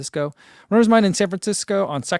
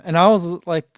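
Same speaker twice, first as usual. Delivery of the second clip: normal talking voice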